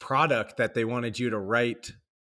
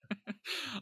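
laughing; 12 semitones higher, 13 dB quieter than usual. Real, not acted. Laughs after own joke.

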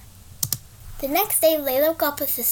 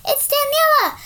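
relieved cry; 12 semitones higher, 6 dB louder than usual. Acted. Rescuer arrives, rider relieved.

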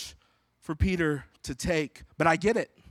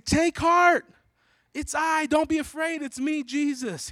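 yelling through wind; 11 semitones higher than usual. Acted. Jesus to frightened disciples over the storm, yelling through the wind. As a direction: call out reassurance across wind and waves. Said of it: "He's yelling through the wind".

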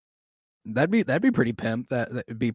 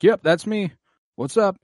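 high, louder mock-casual reply; casual answer; 9 semitones higher, 5 dB louder than usual. Defendant answers the judge calling his name, casually. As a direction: answer breezily in a raised voice.

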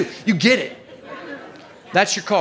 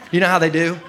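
ordinary talk, nothing marked

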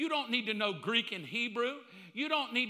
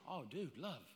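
hushed love; 7 semitones lower, 12 dB quieter than usual. Acted. Soft repetition.

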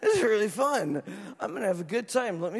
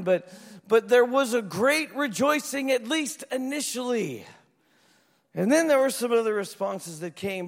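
ordinary talk

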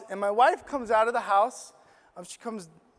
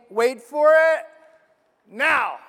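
loud, higher call of wait for it; God's voice; 4 semitones higher, 8 dB louder, slower than usual. Retold or imitated. Preacher to congregation, voicing God loudly. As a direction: boom out God's announcement. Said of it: "It's basically like God saying".

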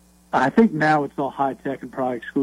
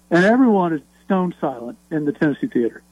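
normal talking voice

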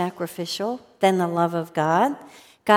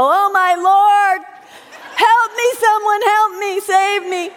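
hammed-up high yelling for help, much higher and louder; fake screaming; 11 semitones higher, 7 dB louder than usual. Ted fakes screams while his dad whips the bathtub, hammed-up yelling. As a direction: overplay a fake cry for rescue like a boy faking a beating. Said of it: "I want you to yell and scream".

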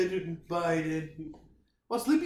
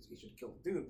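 ordinary talk

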